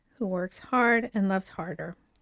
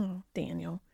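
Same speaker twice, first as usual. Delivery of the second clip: touched sigh; 4 semitones lower, 12 dB quieter than usual. Real, not acted. Touched by his card.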